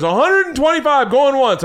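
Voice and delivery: hammed-up auctioneer. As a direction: call the bids like an auctioneer. Auctioneer calling bids.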